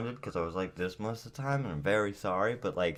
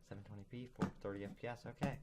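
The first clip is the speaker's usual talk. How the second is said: quiet; 14 dB quieter, faster than usual. Real, not acted. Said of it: "turning this down".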